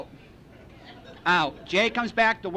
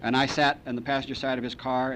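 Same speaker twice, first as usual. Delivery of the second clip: usual speaking voice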